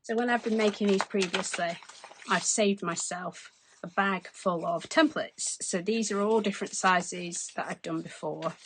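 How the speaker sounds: ordinary delivery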